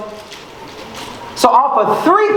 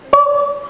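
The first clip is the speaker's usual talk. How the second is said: very high chirped beep like a navigator reroute; high GPS beep. Retold or imitated. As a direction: make a little high electronic ping. GPS sound effect.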